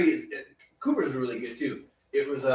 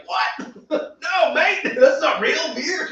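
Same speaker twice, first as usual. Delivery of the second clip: laughing mock Aussie "no mate", pitched way up and slow; mock Australian; 11 semitones higher, 5 dB louder, much slower than usual. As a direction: play an amused Australian scoffing at the beer, high and drawn out. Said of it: "the Australians would be like".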